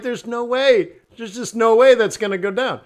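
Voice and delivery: high, loud retold disbelief; scoffing amusement. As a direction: imitate amused Europeans dismissing the idea. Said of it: "And they just laugh".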